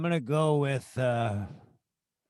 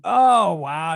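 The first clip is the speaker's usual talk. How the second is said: surprised; 3 semitones higher, 8 dB louder than usual. Real, not acted. Reacting to shouted quote.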